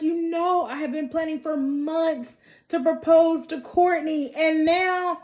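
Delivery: high-pitched mock grievance; aggrieved whining. [name] laments his ruined proposal plans, high mock complaint. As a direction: complain like someone robbed of his big moment.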